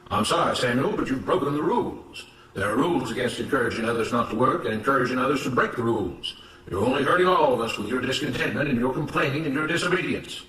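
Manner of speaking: firm, measured moral lecture; stern, righteous overseer. Acted. Mister Smith lecturing Samuel at the whipping post, stern and righteous. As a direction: scold the rule-breaker with self-righteous authority.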